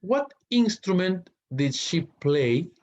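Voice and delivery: slow, high reading of the question; slow teacher read-aloud. Shown as a demonstration. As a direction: read it slowly for the class. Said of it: "teacher reading exercise question".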